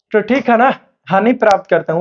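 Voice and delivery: normal talking voice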